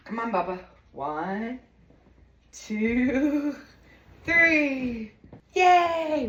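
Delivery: coaxing the cat up with a rising count and cheer; excited counting. Real, not acted.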